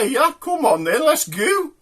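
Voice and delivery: high-pitched put-on woman's voice.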